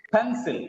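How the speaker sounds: "Pencil" with a high PEN, dropping on -cil; PEN-sil, teacher's model; stress on first syllable. Shown as a demonstration. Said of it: "it's not just pencil".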